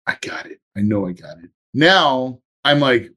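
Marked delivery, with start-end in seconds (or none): 0.1-1.5 much softer, breathed to himself; soft whisper of I got it. Retold or imitated. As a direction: hush it like a secret victory. Quietly sure he has won.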